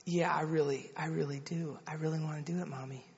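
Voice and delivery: softly imitating an earnest small child; a hint of a quiet, sincere daughter; lightly voiced little girl. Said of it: "And Emma said".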